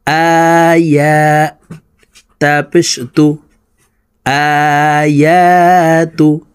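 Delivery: আয়াতুহু drawn out very slowly; slow careful model reading; slow blended word. Shown as a demonstration. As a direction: stretch the joined word syllable by syllable. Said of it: "বানান করে বলে দিচ্ছি".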